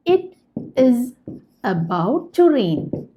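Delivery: drawn-out, word by word; slow model sentence. Shown as a demonstration. Teacher showing learners the English sentence, very slow. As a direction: dictate it slowly to the class. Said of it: "teacher models sentence".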